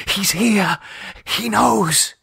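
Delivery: high, tight and frightened. A narrator's reading. David warns Conchita in the dark, tight with fear. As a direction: warn her, voice tight with fear. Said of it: "His voice was tight with fear".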